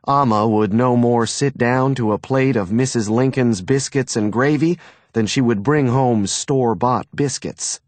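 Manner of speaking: usual speaking voice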